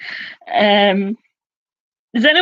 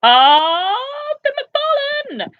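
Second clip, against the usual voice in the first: surprised exclamation; slower than usual. Real, not acted. Spotting a leader on the scoreboard, surprised and high. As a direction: exclaim the name in delighted shock.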